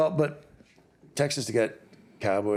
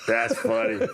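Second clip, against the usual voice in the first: amused, higher voice through laughter; laughing; 11 semitones higher, slower than usual. Real, not acted. Podcast host reacting to looters story, real laughing. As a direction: laugh while saying it.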